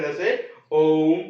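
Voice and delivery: ओम with the vowel held long and slow; prolonged vowel demo. Shown as a demonstration. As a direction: stretch the ओ in ओम well past a normal length. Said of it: "इसमें एक आधी बार ये प्रयोग होते हैं".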